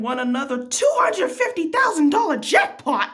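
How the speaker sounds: loud, high hype on the jackpot; game-show style jackpot shout; excited announcement. Acted. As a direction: build to a big, high-pitched prize reveal. Reading out a huge jackpot win.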